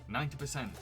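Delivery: normal talking voice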